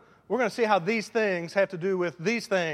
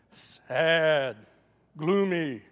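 drawn-out, suddenly dropped glum voice; mock gloomy; 4 dB louder, much slower than usual. Preacher showing the faces he sees outside church, mock gloomy. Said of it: "a lot of times I see".

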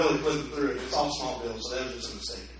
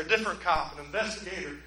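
ordinary delivery

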